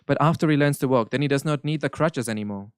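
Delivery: normal talking voice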